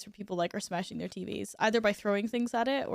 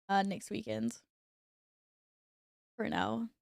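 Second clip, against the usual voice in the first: normal talking voice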